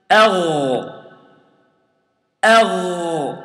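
أغ with a strong throaty gh; ghain demo. Shown as a demonstration. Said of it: "I pronounce the ghain like this".

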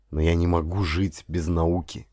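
ordinary delivery, nothing marked

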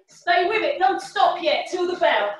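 ordinary talk

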